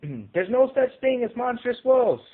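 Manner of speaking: high, loud jeering holler; mocking holler. A narrator's reading. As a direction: holler it from the crowd. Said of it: "a holler bursted from the crowd".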